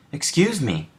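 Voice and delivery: high, small bird voice; sparrow voice. A narrator's reading. Sparrow politely addresses Toad, high bird voice. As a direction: pipe it up like a little bird. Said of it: "said the sparrow".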